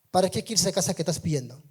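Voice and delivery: ordinary delivery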